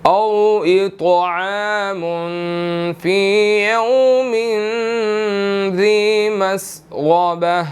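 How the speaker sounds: slow, drawn-out chanted recitation; measured tilawat before the lesson; Quranic recitation. Shown as a demonstration. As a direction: recite it slowly, stretching each vowel. Teacher recites the verse.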